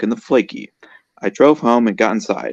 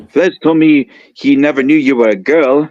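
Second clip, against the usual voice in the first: put-on higher, louder French accent; hammed-up French accent, voice raised; 5 semitones higher, 6 dB louder than usual. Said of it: "This is my French accent".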